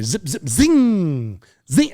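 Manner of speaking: slow drawn-out zip and zing of bullets; mouth-made bullet whizzes; ricochet noises. Retold or imitated. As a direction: imitate ricochets whizzing past, slowly.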